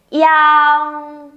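"Yang" as one long high level glide from i into ang; linked sounds. Shown as a demonstration. Teacher has learners join i and ang, linked "Yang". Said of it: "Đọc nối liền vào nào".

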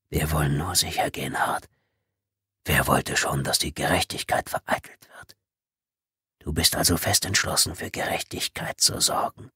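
toneless whisper, unhurried and menacing; hissed whisper. Acted. Intruder reminds Tommy of his orders, whispered. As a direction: whisper the threat right into his ear. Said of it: "zischte die erste Stimme".

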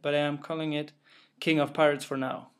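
usual speaking voice

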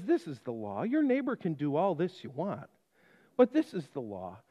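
low, soft, firm officer voice; stern officer. Retold or imitated. Officer laying down the law.

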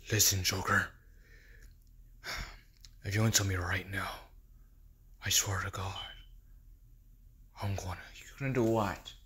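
low rasping threat, fast; threatening whisper. Acted. Batman hissing a threat to Joker.

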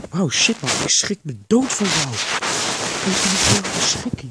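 loud, jolted exclamation; loud shocked outburst; startled. Acted. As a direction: blurt it out loudly after a fright. Startled by the other character.

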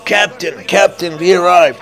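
high, loud excited shout; calling the captain. Pretend. Calls out arrival as crew.

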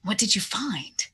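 hushed, conspiratorial ask; whispered as he leans in closer; secretive whisper. Acted. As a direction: lean in and whisper the question.

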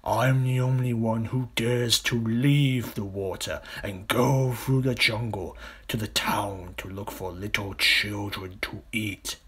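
slow, grand crocodile brag; boastful crocodile. Acted. Big crocodile bragging about jungle hunts, slow and self-important. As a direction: boast with lingering relish. Enormous crocodile figure in story.

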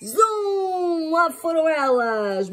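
high, loud, slow cheer; rally them with glee; high, loud, drawn-out delight; excited. Acted. Mother's cheerful rallying cry.

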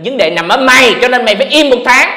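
hammed-up loud voice of Facebook banning him; mock Facebook verdict. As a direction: bark the ban like a smug judge.

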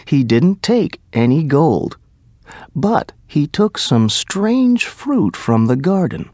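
ordinary delivery, nothing marked

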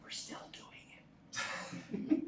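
near-whispered wry aside; hushed aside. Real, not acted. Lecturer slipping students a joke about duels, whispered aside. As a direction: drop to a slow whisper for the punchline.

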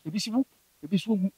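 soft, quick murmur of a voice heard in the ear; a hushed remembered voice whispering in the ear. Retold or imitated. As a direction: echo it faintly and fast. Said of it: "Na mete voice no nso so wɔ m'asom".

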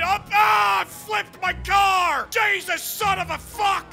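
usual speaking voice

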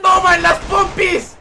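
high, drawn-out shouted taunt; slow, gleeful gamer shout; playful attack yell. Real, not acted. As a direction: holler it as you strike. Attacking enemy in game.

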